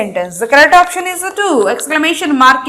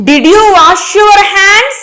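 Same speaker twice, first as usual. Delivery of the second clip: higher, slower, clearly questioning; 5 semitones higher, 4 dB louder, slower than usual. Shown as a demonstration. Teacher demonstrating a question to the class, rising and slow. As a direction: lift the voice on the question.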